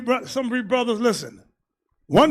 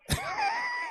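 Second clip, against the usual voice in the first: high shrieking laugh; 12 dB quieter than usual. Real, not acted. Preacher cracking up at own joke.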